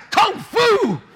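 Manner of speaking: high kung fu yell; mock battle cry. Pastor replaying his kick for laughs, mock battle cry. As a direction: shout it like a martial arts move. Acts out martial arts move.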